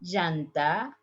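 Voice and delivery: llanta said as "zhanta"; zh sound. Shown as a demonstration. Teacher demos tire with zh. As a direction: llanta voiced like measure. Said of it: "in the three different ways".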